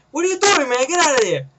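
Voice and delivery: mock usher challenge. Imagined usher confronting a soda drinker, mock stern shout.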